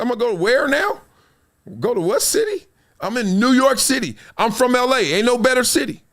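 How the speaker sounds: high-pitched, incredulous retort; acted disbelief. LA native balking at New York City, high-pitched mock disbelief. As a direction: play up the hometown indignation, pitched high. Acting out his indignant younger self.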